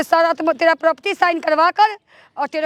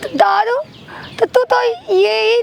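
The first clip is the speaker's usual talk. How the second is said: taunting scorn; 4 semitones higher, slower than usual. Acted. Daughter-in-law telling mother-in-law she's only fit for fetching liquor, slow sneer. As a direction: lean in and sneer each word.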